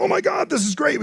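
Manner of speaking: sudden giddy high-pitched thrill; excited brain voice. Retold or imitated. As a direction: squeal it like the brain just got a dopamine hit. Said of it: "our brains go".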